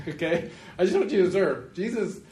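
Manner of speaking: ordinary talk, nothing marked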